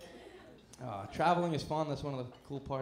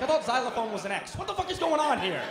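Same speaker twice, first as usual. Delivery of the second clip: incredulous; 7 semitones higher, 8 dB louder than usual. Retold or imitated. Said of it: "I'm like".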